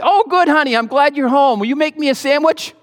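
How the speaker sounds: high, coaxing ask from the hot tub; butter up the wife for a sandwich; sweet-talking husband. Retold or imitated. Husband in the hot tub coaxes his wife, high and sugary. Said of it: "And I say".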